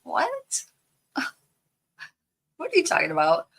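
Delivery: high, squeaky what with a laugh; disbelieving surprise. Retold or imitated. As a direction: shoot way up in mock shock at the news. She retells her disbelief.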